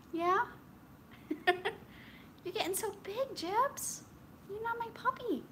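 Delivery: high, sing-song voice for the dog; pet talk. Acted. Coaxing the dog onto the couch, high pet talk. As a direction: coax the puppy up high and sweet.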